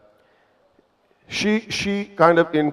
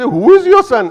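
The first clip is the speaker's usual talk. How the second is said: much higher, louder asking voice; curious audience; 12 semitones higher, 8 dB louder than usual. Retold or imitated. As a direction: audience members ask the proud mother, raised high and loud.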